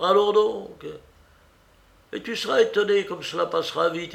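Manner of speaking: high, emphatic urging, settling at the end; earnest aunt. Acted. Aunt urging her niece to bear up, earnest exhortation. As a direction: urge her on, raising the pitch midway. Aunt's figure in the story.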